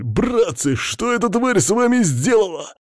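high-pitched frantic hamster wail; panicked yell. A narrator's reading. Hamster begs his bewitched comrades for answers, panicking. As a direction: cry out to them in hysterics. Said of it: "на панически орущего Хамки".

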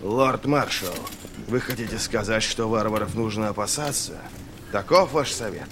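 soft, haughty general's needling question; sullen sneer. A narrator's reading. General needles the lord marshal about fearing barbarians, sneering. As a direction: question him with quiet disdain. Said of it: "Генерал Крой издал угрюмый смешок".

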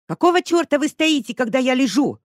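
Lesya, fallen in the mud, yelling at the investigator; yelled. A narrator's reading. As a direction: scream it from the mud. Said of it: "заорала она".

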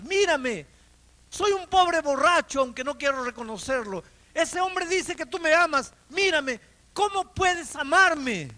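high, loud anguished crying out to God; retold cry of despair, voice rising high and loud; desperate plea. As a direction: pour out a drunkard's broken plea, near tears.

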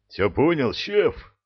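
sharp eager bark. Acted. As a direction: snap it out like a soldier. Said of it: "рявкнул ифрит".

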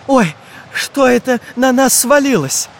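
high-pitched exclamation of surprise; startled cry. A narrator's reading. Boy sees a sack drop on them, crying out startled. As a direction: cry out, forgetting the danger. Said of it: "выкрикнул Чижик".

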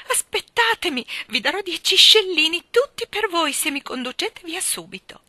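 high, frantic, loud pleading; broken-voiced cry. A narrator's reading. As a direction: shout a desperate bargain to someone leaving. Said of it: "gridò con voce rotta". (grido acuto, supplichevole e disperato)

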